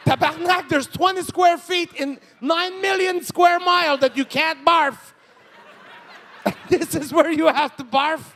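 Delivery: loud, high frustrated rant; exasperated rant. Real, not acted. Comedian ranting about his wife missing the bucket, loud. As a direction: vent it with laughing disbelief. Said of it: "exasperated rant".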